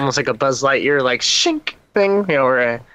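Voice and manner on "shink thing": helmet shink sound. Retold or imitated. Imitating helmet closing sound.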